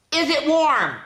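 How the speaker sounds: put-on high, bright child's question; small excited voice asking a guess; high little voice. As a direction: ask it like an eager little donkey.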